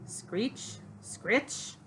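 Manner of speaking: hushed screech with a rising end; eerie scratching noise. Acted. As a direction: creep in softly, each word sliding up. Noise at night in the story.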